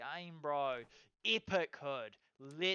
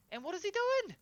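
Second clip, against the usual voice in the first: very high, loud disbelieving squeal; retold thought, pitched way up in disbelief; incredulous squeak; 5 dB louder than usual. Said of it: "I'm thinking to myself".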